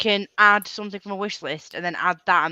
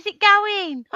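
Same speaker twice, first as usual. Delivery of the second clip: excited; 4 dB louder, slower than usual. Real, not acted. Excited at seeing a plushie.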